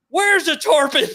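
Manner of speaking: very high, loud acted-out yell; frantic shouted question. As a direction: yell back in excited confusion. His shouted question, retold.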